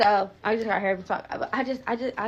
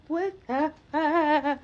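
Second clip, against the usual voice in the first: stunned disbelief; 7 semitones higher, much slower than usual. Retold or imitated. Told meds delayed again.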